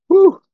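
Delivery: sharp high exhale of awe; high loud whew. Real, not acted. Pastor reacting to the destroyer verse, high loud whew.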